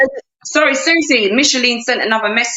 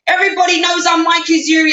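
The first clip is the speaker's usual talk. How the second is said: raised, whining boast through mock tears; mock crying; 4 semitones higher than usual. Scammer crying about being well known, mock wail. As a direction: imitate the pleading caller. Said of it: "He can cry".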